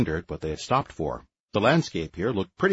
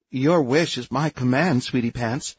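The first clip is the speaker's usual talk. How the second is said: slow, teasing sultry purr; sultry and mocking, drawn out; mockingly sultry; 5 semitones higher, slower than usual. A narrator's reading. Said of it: "came Mother's mockingly sultry voice".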